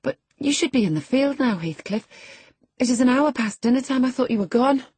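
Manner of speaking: put-on high-pitched girl's voice, urging; young Catherine, uneasy.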